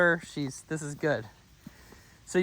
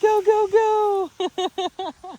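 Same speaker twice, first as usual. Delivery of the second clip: squealing high, loud encouragement to the dog; high cheering; 6 dB louder, much slower than usual. Real, not acted. Trainer hypes her dog to chase the toy, squealing high.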